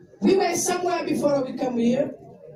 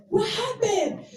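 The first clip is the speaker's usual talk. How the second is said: retold shock; 9 semitones higher than usual. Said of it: "shocked to hear the story".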